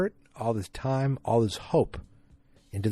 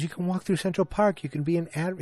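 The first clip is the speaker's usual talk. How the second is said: much higher, teasing woman's voice; mocking high voice; 8 semitones higher than usual. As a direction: tease him in a lifted voice. Midge voiced mocking Don.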